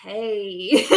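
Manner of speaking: drawn-out flirty greeting with a laugh; hammed-up come-on; playful, giggly flirt; mock flirty. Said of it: "flirt like".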